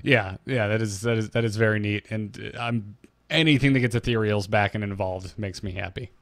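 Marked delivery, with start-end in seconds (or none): none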